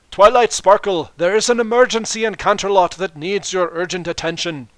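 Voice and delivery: loud, high regal announcement; Princess Luna. Acted. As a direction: royal herald of urgent news. Princess Luna arrives announcing emergency.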